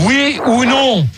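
slow, word-by-word repeat of the question; slow, insistent "Oui ou non", each word separated; exasperated repetition. Real, not acted. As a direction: spell out "Pensez-vous la revoir" slowly and firmly. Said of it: "je répète la question pour la unième fois".

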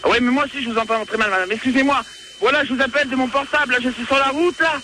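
ordinary delivery

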